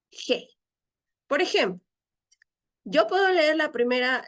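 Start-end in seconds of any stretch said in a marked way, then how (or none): 0.1-0.5 "G" said as a rough Spanish jota sound; Greek rough breathing. Shown as a demonstration. Teacher showing students the rough-breathing sound, "G" said like Spanish "je". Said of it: "pronunciar como si fuera una".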